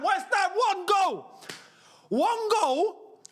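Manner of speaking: high desperate urging; pleading cheer. Real, not acted. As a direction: beg the team for a goal. Urging team on.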